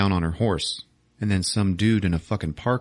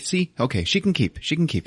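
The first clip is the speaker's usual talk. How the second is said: higher, placating chimp voice; a chimp soothing the girl with a higher voice; eager ape; 8 semitones higher than usual. Retold or imitated. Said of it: "he's like".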